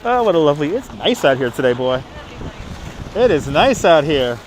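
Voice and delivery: usual speaking voice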